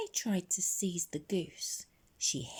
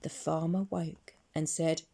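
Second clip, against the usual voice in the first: plain reading voice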